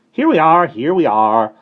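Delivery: high, loud harsh cry; shrill it from the sill; shrill call. Acted. Starling returning to the sill, shrilling high and loud. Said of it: "a harsh voice shrilled".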